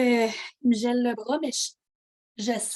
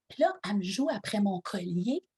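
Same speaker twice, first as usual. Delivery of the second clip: ordinary talk, nothing marked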